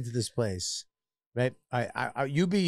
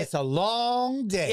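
higher, drawn-out mock complaint; hammed-up weariness; playing weary counter woman; 5 semitones higher, slower than usual. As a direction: stretch the whine of a tired cashier. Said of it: "I'm her".

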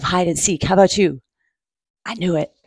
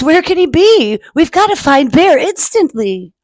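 higher, louder, urgent Beetle; worried urgency; 7 semitones higher, 4 dB louder than usual. Acted. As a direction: raise the pitch as if the search is urgent.

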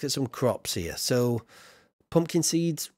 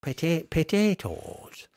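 slow, higher Irish "potatoes"; attempted Irish accent; 4 semitones higher, slower than usual. Streamer admiring a field of crops, mock Irish accent. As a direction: draw the word out in a playful Irish brogue. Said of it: "say that in the Irish accent".

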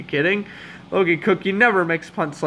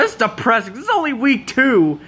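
exasperated despair; 6 semitones higher than usual. Real, not acted. Despairing at his losing team.

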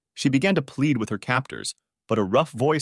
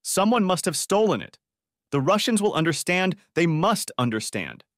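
desperate cry; 8 semitones higher than usual. A narrator's reading. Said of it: "she cried out desperately".

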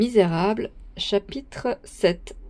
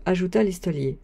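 ordinary delivery

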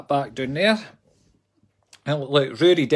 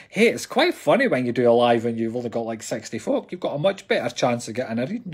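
usual speaking voice

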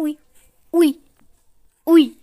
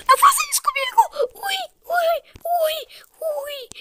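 very high falsetto begging; squealing plea. Real, not acted. Gamer begging an opponent for mercy, falsetto squeal. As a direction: plead in a tiny squeal, ending in high yelps. Pleading with opponent mid-danger.

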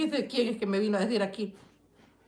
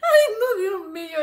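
laughing; 8 semitones higher, 6 dB louder than usual. Real, not acted. Helpless laughter at comment.

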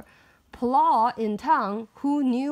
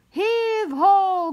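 loud straining chant, higher; heave ho pulling; 9 semitones higher, 7 dB louder than usual. Acted. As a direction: heave it out like pushing hard. Crowd pushing elephant.

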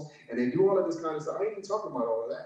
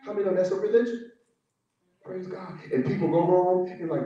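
ordinary talk

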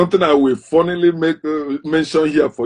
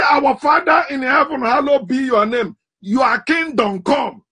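mock pious shout; 7 semitones higher than usual. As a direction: cry the prayer out high like a fervent worshipper. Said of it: "He said".

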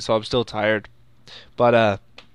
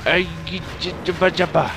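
higher-pitched babbled attack noise; nonsense battle cry; 5 semitones higher than usual. Pretend. Gamer attacking Baragon, babbled battle cry. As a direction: babble a playful fighting cry.

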